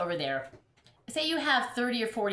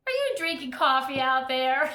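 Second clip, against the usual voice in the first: man calling across the river, a bit louder; retold yell; 5 semitones higher, 6 dB louder than usual. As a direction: call it out across the water. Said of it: "and some guy yells".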